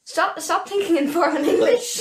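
scolding a friend while cracking up; laughing. Real, not acted. Laughing at a co-host overthinking a slang word.

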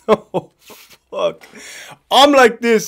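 ordinary delivery

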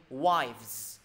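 WIVES with voiced v-z at the end; model pronunciation. Shown as a demonstration. Teacher showing the plural, WIVES with clear v-z ending. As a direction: stretch WIVES and sound every final consonant. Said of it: "từ này sẽ đọc gì?".

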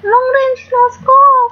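slow, high and loud hype; drawn out like a hype call; excited. Real, not acted. Hyping the game shot.

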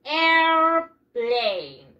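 "Airplane" long and ringing, in two drawn-out parts; "Airplane" as a slow "air... plane"; repeat-after-me model. Shown as a demonstration. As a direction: stretch "Airplane" out for the class to copy. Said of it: "Repeat after me".

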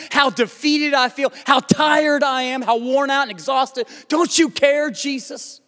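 high, insistent appeal; desperate pleading. Acted. Worn-out believer crying to God, desperate pleading. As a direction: pile up urgent, pleading questions.

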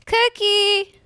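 high, drawn-out call for someone lost. Real, not acted. Player searching for lost Cookie, calling out high.

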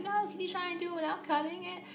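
high-pitched mild whine; retold pleading. Retells pleading with boyfriend.

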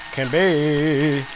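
drawn-out complaint. Real, not acted. Complaining about his player.

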